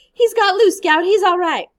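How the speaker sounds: loud, high cry of relief; cried out. A narrator's reading. Jem crying out to Scout in loud relief. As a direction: burst out with joy and relief. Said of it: "until Jem cried".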